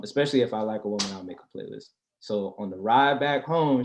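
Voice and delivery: usual speaking voice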